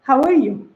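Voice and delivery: "How are you" linked in one flow; easy, connected "How are you"; smooth casual greeting. Shown as a demonstration. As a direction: run "How are you" together with a falling end.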